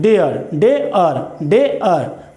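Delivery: "डेयर" said slow, stretched "डे-अर"; slow drawn demo. Shown as a demonstration. Teacher slowly sounding out "डेयर" for the class. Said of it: "तो यहाँ क्या बोलते हैं?".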